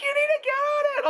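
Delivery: high, loud insistent fan voice; imitating a fan yelling encouragement; mock pushy yell. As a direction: bark it at her like a pushy mom. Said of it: "felt like I was being screamed at".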